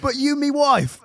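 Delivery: high, loud British plea; hammed-up British husband, aghast; British accent. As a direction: wail it in a broad British accent. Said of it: "He doesn't speak American".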